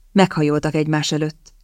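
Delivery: usual speaking voice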